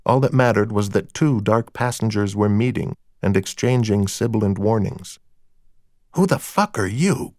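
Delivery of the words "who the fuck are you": high, edgy hostile demand; mildly angry, higher-pitched question; hostile challenge. A narrator's reading. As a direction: challenge a stranger with contempt. Hostile club owner challenges stranger.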